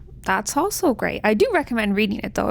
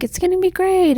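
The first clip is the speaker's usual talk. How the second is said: high, breezy mock cheer; everyone being upbeat; 8 semitones higher than usual. Family reassures the mother about the wedding, mock-cheerful. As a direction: chirp it much higher, dismissively upbeat. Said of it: "And they're like".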